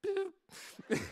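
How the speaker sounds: one high, drawn-out beep; imitated scanner beep. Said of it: "I'm like".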